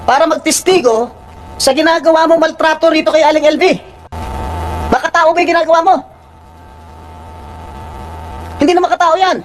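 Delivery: real outrage, sustained high pitch. Real, not acted. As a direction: berate the accused in a high, indignant voice. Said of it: "I'm sorry for, uh, raising my voice".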